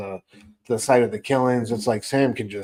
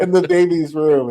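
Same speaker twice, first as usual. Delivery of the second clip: mock panicked yell; 5 semitones higher, 7 dB louder than usual. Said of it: "She yells to her husband".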